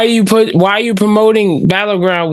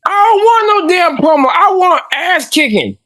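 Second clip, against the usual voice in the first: wrestling promo; 10 semitones higher than usual. Pretend. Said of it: "my Dark State impression".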